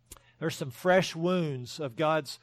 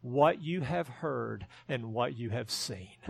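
slow, soft and lower, tenderly; gentle, loving; 5 semitones lower, 5 dB quieter, slower than usual. Retold or imitated. Jesus answering John's doubting disciples, slow gentle tenderness. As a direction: ease the words out like a kind reassurance. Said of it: "Jesus very gently, very lovingly says".

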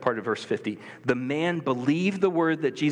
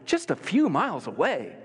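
incredulous; 8 semitones higher than usual. Retold or imitated. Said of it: "I'd look at the map and be like".

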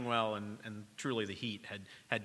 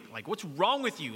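retold angry scolding; 5 semitones higher, 4 dB louder than usual. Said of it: "I'm angry".

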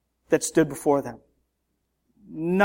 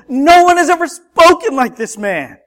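awed exclaim; 7 semitones higher, 8 dB louder than usual. Retold or imitated. Temple guards quoted in awe.

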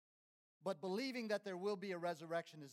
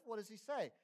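ordinary talk, nothing marked

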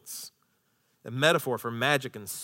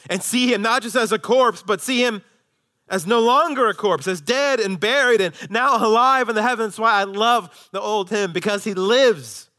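high, loud sermon proclamation; full-force preaching; 8 semitones higher, 7 dB louder than usual. Real, not acted. Preacher pointing the church to the living Christ, full-force preaching. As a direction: raise the voice and celebrate the resurrection.

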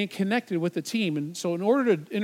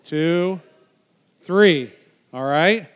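loud counting; 7 dB louder, much slower than usual. Real, not acted. Counting spins aloud.

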